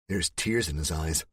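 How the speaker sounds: ordinary delivery, nothing marked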